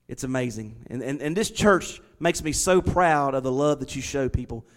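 ordinary delivery

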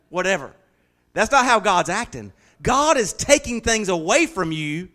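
ordinary talk, nothing marked

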